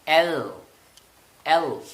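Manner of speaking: normal talking voice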